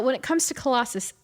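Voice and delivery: normal talking voice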